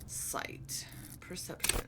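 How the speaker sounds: murmured, unvoiced words; whispering. Real, not acted. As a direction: whisper the options to yourself. Muttering choices to self.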